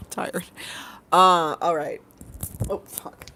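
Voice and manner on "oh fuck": ordinary talk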